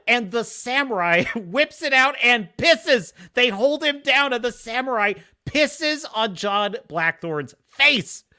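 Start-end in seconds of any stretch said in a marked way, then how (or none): none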